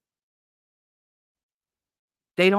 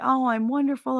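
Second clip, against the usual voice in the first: mocking; 6 semitones higher than usual. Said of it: "you know, like".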